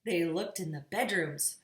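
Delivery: usual speaking voice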